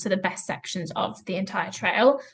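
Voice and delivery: ordinary talk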